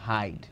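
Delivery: হাইড said softly and slowly, the long ai glide drawn out; soft sound demo. Shown as a demonstration. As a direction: softly model হাইড for the class, ai stretched. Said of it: "এখানে কিন্তু একটা হবে হাইড".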